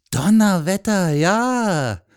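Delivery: high, loud, drawn-out astonishment; impressed exclamation; amazed. A narrator's reading. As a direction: marvel out loud at the boy. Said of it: "sagte der Mann verwundert".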